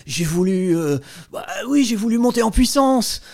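sheepish accused mumbling an excuse in court; a guilty defendant lamely excusing himself, lightly voiced; embarrassed accused. The author acts out the accused excusing himself in an old French film trial, sheepish. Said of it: "l'accusé, dans un silence, essaye de s'excuser".

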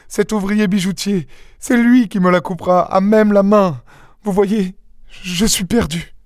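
high-pitched, frightened woman's voice; terrified. A narrator's reading. Young wife realising her husband's scheme, terrified. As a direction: recall it in mounting dread, voice high. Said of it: "étreinte par une idée qui la terrifia".

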